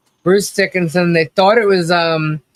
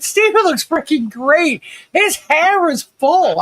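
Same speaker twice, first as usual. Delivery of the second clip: amazed; 9 semitones higher, slower than usual. Retold or imitated. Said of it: "I thought".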